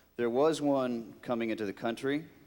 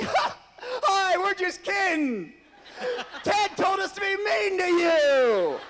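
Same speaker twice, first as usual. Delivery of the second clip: much higher, louder, giggling; laughing reveal; 7 dB louder, slower than usual. Retold or imitated. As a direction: gleefully confess the prank. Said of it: "Lucy and Renee come back".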